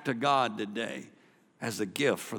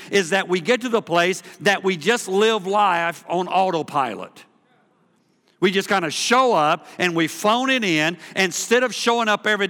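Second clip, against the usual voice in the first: louder, driving sermon delivery; full-force preaching; 9 semitones higher, 8 dB louder than usual. Acted. Pastor rallying the congregation, full-force preaching. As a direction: preach it to the back row, loud and building.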